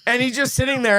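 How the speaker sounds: ordinary talk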